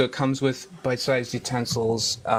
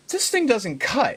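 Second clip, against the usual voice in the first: mock disbelief; 11 semitones higher, slower than usual. Quoting own dismay at knife.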